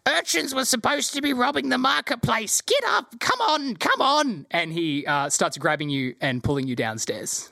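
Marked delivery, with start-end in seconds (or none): 0.1-4.5 high, squeaky rat shouting. Acted. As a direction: bark orders in a squeaky rat voice. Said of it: "He says".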